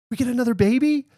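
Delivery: very high, loud squeal of hope; imitated excited child. Young daughter hopes for a sibling, high excited squeal. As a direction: squeak it like a thrilled little girl. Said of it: "And then Sue gets excited".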